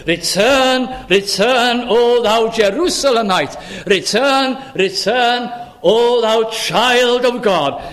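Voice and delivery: high, insistent repeated plea; divine summons. Acted. God calls his straying child home, urgent pleading summons. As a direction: call out each return with lifted pitch. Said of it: "So what he's saying is this".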